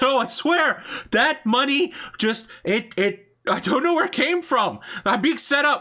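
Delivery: terrified victim yelling to the mob boss that he's being set up; panicked pleading. Acted. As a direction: shriek out your innocence. Victim denies stealing the money.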